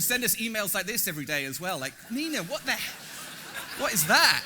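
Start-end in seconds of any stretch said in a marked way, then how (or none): 2.1-4.4 laughing disbelief. Retold or imitated. Reacting to odd email, laughing.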